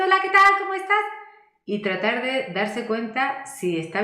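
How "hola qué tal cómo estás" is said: very high, loud, thin squeaky voice stuck in the head; head voice demo. Shown as a demonstration. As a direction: squeak it out way up high, disconnected from the chest. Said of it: "con una voz de pito". (demostración de voz de pito, muy aguda y fuerte)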